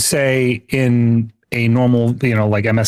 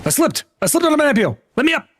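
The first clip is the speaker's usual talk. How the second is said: high-pitched squealed game plea; hammed-up panic in a much higher voice; mock panic; faster than usual. Panicked pleading after slipping in game.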